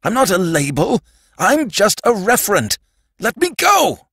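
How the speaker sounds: high, panicked outburst building in pitch; acted scream. A narrator's reading. Sandman demanding to be let go, screaming. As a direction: build to a desperate shriek. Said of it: "screamed the Sandman".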